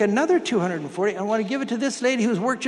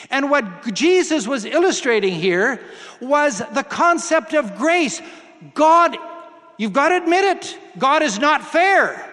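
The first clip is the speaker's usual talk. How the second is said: raised, emphatic pulpit voice; preaching; 4 semitones higher, 4 dB louder than usual. Acted. Preacher telling the congregation, emphatic pulpit delivery. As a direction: drive the point home to the congregation.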